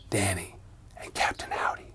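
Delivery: fading to a whisper; closing the ghost story in a fading whisper; spooky whisper. Acted. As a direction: trail off into a hush to end the scary tale.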